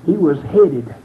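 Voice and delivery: rising into a sung whoop, cry in the voice; preaching. Acted. Preacher whooping to the congregation, crying out. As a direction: preach it, building to a tuneful shout.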